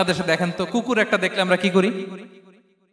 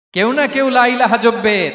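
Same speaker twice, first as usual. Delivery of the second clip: raised, loud and drawn out; ringing preacher's declaration in retold speech; emphatic proclamation; 5 semitones higher, 5 dB louder than usual. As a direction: proclaim it slowly at a higher pitch. Same line repeated as proclamation.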